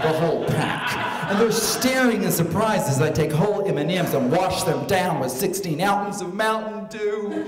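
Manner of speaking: ordinary delivery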